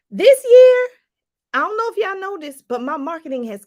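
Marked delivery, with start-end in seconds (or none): 0.1-0.9 high, loud burst on this year; sudden excited shout. Real, not acted. Excited exclaim to audience.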